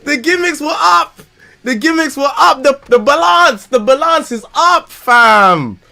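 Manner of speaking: high, loud hyped exclamation; excited shout. Real, not acted. Streamer hyping event memories, high excited shouts. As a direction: hype it up.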